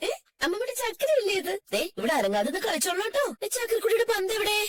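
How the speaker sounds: high, singsong grandmother cooing; lilting, affectionate play voice; doting baby talk. Acted. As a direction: coo playfully to a toddler. Grandmother playing with toddler.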